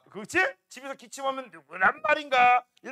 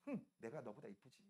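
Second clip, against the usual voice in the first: much softer, lower self-satisfied murmur; smug inner thought; 10 semitones lower, 22 dB quieter than usual. Acted. Jealous girl secretly gloating over her friend, soft and smug. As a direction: think it smugly to yourself. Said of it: "속으로 이렇게 생각한다".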